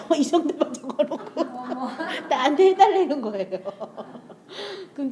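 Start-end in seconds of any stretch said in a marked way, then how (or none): 0.1-3.6 words broken by real laughter; giggling through the complaint; laughing while telling. Real, not acted.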